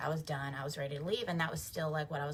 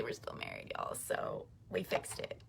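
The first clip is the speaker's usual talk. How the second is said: whispered aside; 12 dB quieter than usual. Real, not acted. Aside to viewers.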